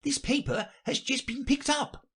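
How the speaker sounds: very high rabbit voice; high, eager courtier rabbit. Acted. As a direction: announce it urgently, high-pitched. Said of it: "jumping up in a great hurry".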